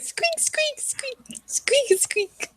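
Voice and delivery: tiny high-pitched mouse squeaks; mouse squeaking. Acted. Player pretending to talk to a pet mouse, high soft squeaking. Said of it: "I'm gonna be like".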